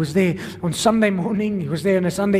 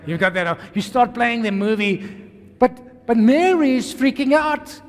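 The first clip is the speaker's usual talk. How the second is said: ordinary talk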